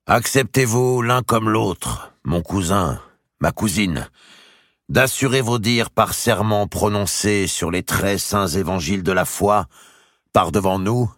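lightly voiced solemn royal demand; king's solemn demand. A narrator's reading. The king asking both to swear on the gospels, solemn. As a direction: require the oath with weight.

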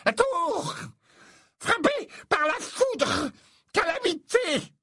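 very high, slow, ominous prophecy; prophesying seer. Acted. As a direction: foretell the disaster with theatrical dread. Trelawney theatrical prophecy.

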